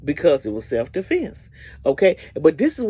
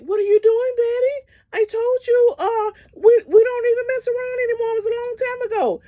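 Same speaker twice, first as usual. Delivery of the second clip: high, frantic, tearful begging; crying plea. Retold or imitated. Kandi begging Betty holding an axe, high frantic crying. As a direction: beg for your life through tears.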